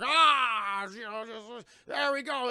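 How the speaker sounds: high, strained villain voice; hammed-up gloating villain; mock villain taunt. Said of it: "Zod's like".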